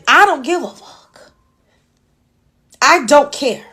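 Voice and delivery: normal talking voice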